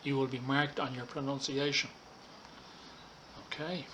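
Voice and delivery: normal talking voice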